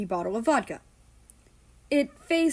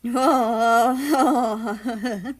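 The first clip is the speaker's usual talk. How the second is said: dreamy laugh; 3 semitones higher, 6 dB louder than usual. Acted. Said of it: "Morning laughed out dreamily".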